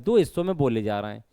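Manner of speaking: usual speaking voice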